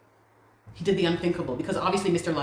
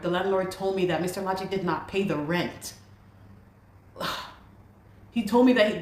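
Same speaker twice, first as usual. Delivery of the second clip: ordinary talk, nothing marked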